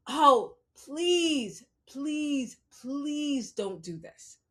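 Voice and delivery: drawn-out plea, loud at first; pleading. Real, not acted. Begging listeners not to do this, pleading. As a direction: beg slowly, easing off after a loud start.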